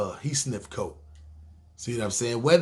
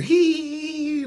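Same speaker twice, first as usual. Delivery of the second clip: long, high held "heee"; sustained squeaky eee; high squeal; 13 semitones higher than usual. Acted. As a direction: let out a drawn-out high-pitched squeal. Mimics a high squeal mid-description.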